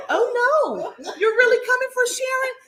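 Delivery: very high, laughing disbelief; hammed-up friend feigning dismay; mock shocked friend. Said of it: "her friend is walking over there like".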